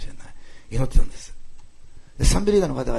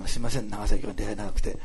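normal talking voice

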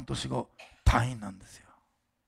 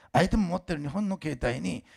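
usual speaking voice

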